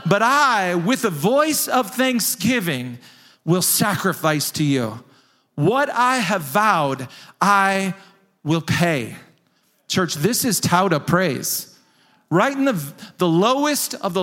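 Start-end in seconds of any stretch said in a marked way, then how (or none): 0.1-9.2 slow, weighty proclamation of the vow; declaiming scripture. Acted. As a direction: pound out each phrase of the vow. Preacher proclaims Jonah's vow.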